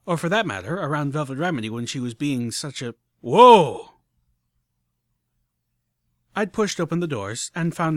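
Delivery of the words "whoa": sudden loud startled gasp; startled whoa. A narrator's reading. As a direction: jolt of shock, loud. Sudden vertigo at open sky.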